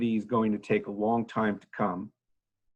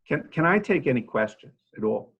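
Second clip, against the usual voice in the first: ordinary talk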